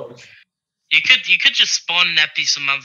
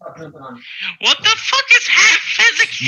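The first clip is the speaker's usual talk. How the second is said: loud, very high, drawn-out disbelief; laughing, stretched-out shout of confusion; incredulous outburst; 11 semitones higher, 5 dB louder, slower than usual. Real, not acted. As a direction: blurt it in high-pitched bafflement. Baffled outburst at a game rule.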